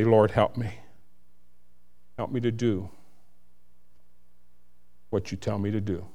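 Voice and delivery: low, soft plea with long pauses; hushed prayer. Real, not acted. Preacher asking the Lord for help, hushed and earnest. Heartfelt prayer spoken aloud.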